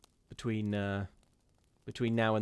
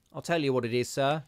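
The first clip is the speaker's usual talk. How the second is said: put-on higher, louder scornful old charwoman; the charwoman scoffing at ghosts, higher and louder; superior charwoman; 3 semitones higher, 5 dB louder than usual. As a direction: sniff down at the gentleman's fears. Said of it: "sniffed in a superior manner".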